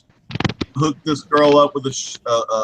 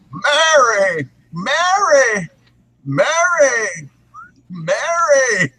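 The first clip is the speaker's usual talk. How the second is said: crying out Mary; 9 semitones higher, much slower than usual. Retold or imitated. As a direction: call out Merry as if searching desperately. Quoting It's a Wonderful Life.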